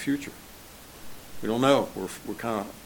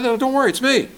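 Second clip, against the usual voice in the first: gently reassuring Jesus; 13 semitones higher, 6 dB louder than usual. Acted. Jesus reassures frightened disciples.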